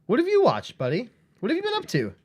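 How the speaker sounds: high, bright mock host greeting; chirpy host voice. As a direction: pitch way up like a cheesy host. Mock talk-show host greeting.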